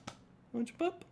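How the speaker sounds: very high, soft pet voice; coaxing pet talk. Real, not acted. Host invites the pet to jump up, high coaxing. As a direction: coax a pet in a tiny high voice.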